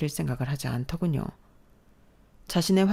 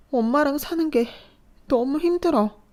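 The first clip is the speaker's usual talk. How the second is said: despairing cry; 10 semitones higher, 3 dB louder than usual. Acted. Daughter confesses despair.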